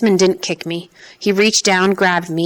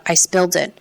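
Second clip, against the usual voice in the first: plain reading voice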